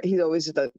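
normal talking voice

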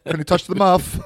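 high, loud, hammy quote voice; hammed-up commentator. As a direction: ham it up like a pundit. Quote repeated, far, high.

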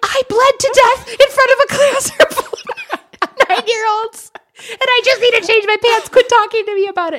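high, loud, half-crying rant; frantic outburst. Retold or imitated. To her worried husband after rushing home, frantic and tearful. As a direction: blurt it out, near tears and fed up.

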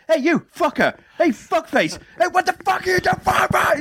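high-pitched cartoon-kid rant; Morty shouting. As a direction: yell sweary abuse in a high, strained Morty impression. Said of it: "literally just Morty shouting at you".

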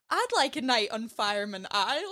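put-on lightly done Scottish accent, quoting a film line; a hint of a Scottish hairdresser; mock Scottish accent, playful. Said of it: "That was a really good Scottish accent".

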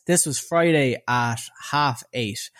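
normal talking voice

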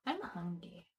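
hushed hungry whisper. Acted. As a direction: whisper it weakly. Whispered hunger complaint.

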